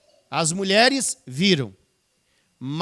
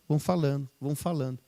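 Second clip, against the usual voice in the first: each repeat softer, trailing off; fading away; 5 semitones lower, 5 dB quieter, faster than usual. Shown as a demonstration. Preacher trailing off as the disciples talk on. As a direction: let the repeats die away. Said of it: "repeated, fading".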